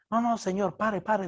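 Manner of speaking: lightly acted urgent begging; mock desperate plea. Passenger pleading with the driver to pull over, mock desperate. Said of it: "vio a la señora desesperada".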